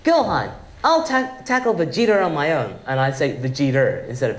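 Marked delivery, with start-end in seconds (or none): 0.0-2.8 a hint of Goku, higher start; Goku line. Actor recalls a Goku line where his accent slipped, lightly voiced. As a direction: call out to Gohan like the hero. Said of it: "when I would say".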